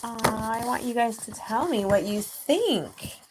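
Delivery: ordinary delivery, nothing marked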